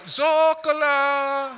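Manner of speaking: slow, loud drawn-out Italian yell; yelled insult. Retold or imitated. Bronx neighbours yelling at a man out the window, slow and loud. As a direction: shout it from the window.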